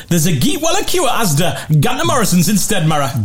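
lilting put-on Geordie dialect; Geordie accent. Shown as a demonstration. Quizmaster giving dialect usage, Geordie accent. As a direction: say it like a Newcastle local.

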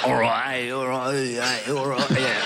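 put-on Dudley accent warm-up. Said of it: "how's your Iping accent".

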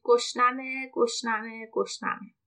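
ordinary delivery

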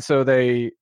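usual speaking voice

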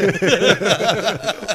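big high burst of laughing; loud laughter. Real, not acted. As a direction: laugh hard at the joke. Hosts laugh at joke.

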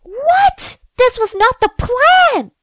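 shocked and indignant, rising high; shocked outburst. A narrator's reading. Seeing them kissing.

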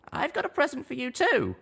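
high, plain little mare voice; a small pony chatting; high little pony voice, chuckling aside; young mare. A narrator's reading.

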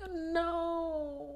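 high, soft, wailing "No"; a quiet, hammed-up crying wail; mock sobbing. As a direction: whimper it like a heartbroken fan. Reacting to Big's treatment.

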